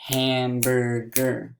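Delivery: HAMBURGER slowly in three beats, ham-bur-ger; slow, separated HAMBURGER; split into syllables. Shown as a demonstration. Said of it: "how many syllable sounds was that".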